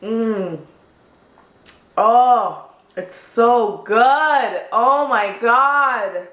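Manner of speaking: loud, drawn-out delight at the taste; slow delighted moan. Real, not acted. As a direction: savor every word, slow and loud. Savoring the first sip.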